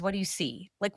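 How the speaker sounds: normal talking voice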